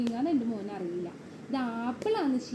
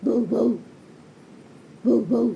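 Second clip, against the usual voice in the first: loud, short double woofs; dog bark; 6 dB louder than usual. Shown as a demonstration. Parent showing toddler how Kevin cries, loud imitated barks. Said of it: "Kevin എങ്ങനെ കരയണേ".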